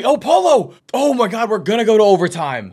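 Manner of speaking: high and loud outburst; excited shout. Real, not acted. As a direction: yelp as the game goes to overtime. Shot forces overtime.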